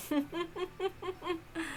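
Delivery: soft sinister chuckle. Acted. Relishing their despair.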